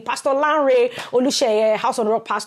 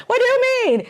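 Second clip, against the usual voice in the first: mock surprise; 14 semitones higher, 3 dB louder, faster than usual. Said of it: "Pastor Paul was like".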